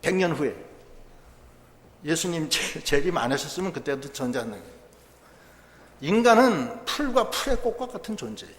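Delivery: usual speaking voice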